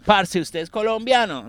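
put-on a light paisa Colombian accent. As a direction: ask like a Medellín local. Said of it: "I'm like".

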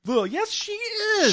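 very high, stretched-out mock rebuttal; exasperated high-pitched comeback; emphatic retort. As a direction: squeal the contradiction slowly, as if it's obvious. Retort to the whining fans.

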